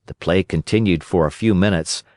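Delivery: usual speaking voice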